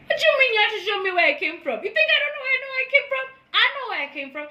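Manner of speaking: high, fast, outraged rant; venting at the friend who kept the screenshot, high and fast. Real, not acted. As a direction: fire it off in disbelief.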